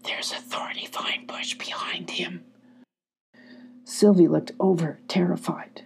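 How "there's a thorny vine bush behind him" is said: breathy hushed whisper. A narrator's reading. Eric warning Sylvie in a hushed whisper. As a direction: whisper it so the enemy can't hear. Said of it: "He whispered to Sylvie".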